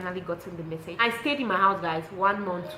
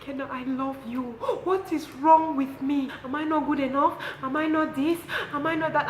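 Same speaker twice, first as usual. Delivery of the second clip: mock a boyfriend pleading through tears; hammed-up heartbroken plea; imitating his crying plea; 8 semitones higher, 5 dB louder than usual. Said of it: "He started crying".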